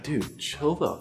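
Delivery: hushing a friend. Acted. Friends telling the drunk to calm down, hushed. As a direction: say it slowly under your breath.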